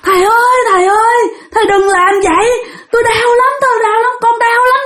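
high-pitched tearful begging; pained crying out. Retold or imitated. Hurt spirit begging the master to stop stabbing, wailing. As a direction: wail as if begging for mercy. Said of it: "bắt đầu la lên".

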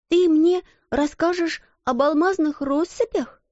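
put-on high childish hopeful asking; eager child. A narrator's reading. Crying girl asking friend for a story. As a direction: ask like a little girl hoping.

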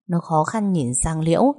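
ordinary delivery, nothing marked

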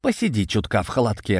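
ordinary delivery, nothing marked

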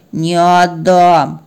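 very slow, drawn-out refusal; stubborn dog. Acted. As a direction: dig in sullenly, stretching the vowels. (упрямо, очень протяжно)

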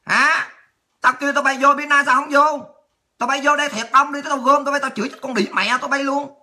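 ordinary talk

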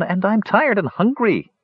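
high-pitched whine; complaining. A narrator's reading. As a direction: whine like a grumpy boy. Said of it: "complained Talarin".

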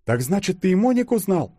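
high-pitched female exclamation; exclaiming. A narrator's reading. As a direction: burst out with a surprised question, high voice. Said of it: "воскликнула Юля". (восклицая, высоким голосом)

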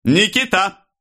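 very high, falling summons; loud call. A narrator's reading. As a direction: call «Никита» out high, as across a crowd. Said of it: "громко позвал Становой". (громкий высокий зов)

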